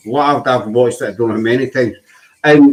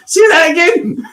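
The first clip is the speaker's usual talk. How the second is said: high, loud disbelieving yell; host's amused, astonished demand; startled shout; 3 dB louder than usual. Real, not acted. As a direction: shout it back in shock. Host startled by Scottish attempt.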